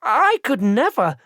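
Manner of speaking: high, slow, appalled denial; horrified protest. A narrator's reading. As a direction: plead it in horror. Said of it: "His voice squeaked with surprise and horror".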